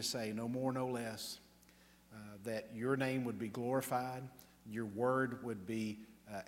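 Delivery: hushed at the Amen; pastoral prayer. Acted. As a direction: pray it humbly for the congregation. Leads the closing prayer.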